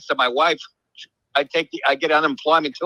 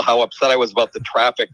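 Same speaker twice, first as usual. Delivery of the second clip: usual speaking voice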